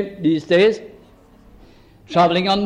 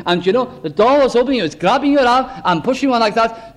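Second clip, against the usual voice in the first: normal talking voice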